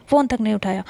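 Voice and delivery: plain reading voice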